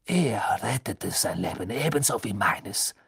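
unvoiced bitter whisper. Snape whispering bitterly to Harry about his father. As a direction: whisper it with contempt.

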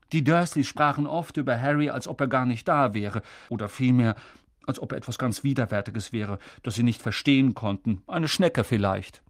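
ordinary delivery

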